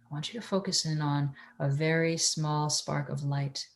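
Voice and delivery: soft, hushed meditation guide; airy breathy mystical healer. Acted.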